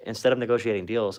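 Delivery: ordinary delivery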